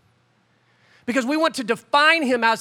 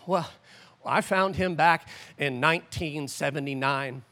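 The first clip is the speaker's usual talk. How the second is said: put-on much lower, softer old churchgoer; a hammed-up old saint remembering; old-timer voice; 7 semitones lower, 4 dB quieter than usual. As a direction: croak it like an elderly believer. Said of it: "I don't know why I'm talking like that".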